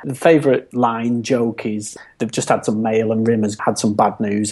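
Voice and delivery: ordinary talk, nothing marked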